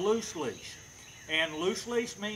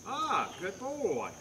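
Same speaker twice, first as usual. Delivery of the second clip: slow warm praise; 3 semitones higher, 3 dB quieter, much slower than usual. Real, not acted. Praising dog for holding still.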